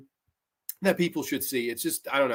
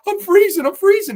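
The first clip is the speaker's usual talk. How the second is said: much higher and louder, a mock wail; hammed-up cold complaint, pitched way up; freezing loser; 13 semitones higher, 9 dB louder than usual. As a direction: whine it like a half-naked man losing at cards. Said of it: "he's like".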